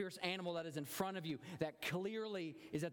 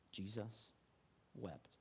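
hushed reverence; 7 semitones lower, 7 dB quieter, slower than usual. Real, not acted. Hushed reverent verse reading.

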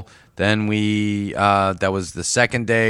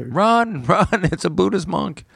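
mock panic; 8 semitones higher than usual.

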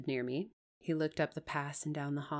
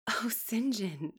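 higher, understated cry of appeal; pleading cry; 6 semitones higher, slower than usual. A narrator's reading. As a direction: appeal to him with mild distress. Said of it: "I cried".

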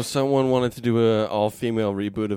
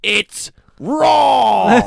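angry boss; 9 semitones higher, 9 dB louder than usual. Pretend. Continuing loud Ramsay bit.

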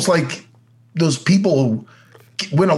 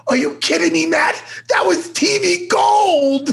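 thrilled producer; 13 semitones higher, slower than usual. Retold or imitated. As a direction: squeal it like he just struck gold. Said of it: "And he goes".

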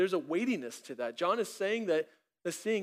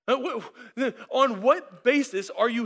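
flustered angel; 5 semitones higher, 6 dB louder than usual. Acted. Supervisor angel flustered, repeats question.

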